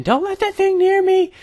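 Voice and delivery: high panicked mock scream; imitating wife screaming. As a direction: squeal it like the cat is about to get you. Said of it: "I can hear her screaming".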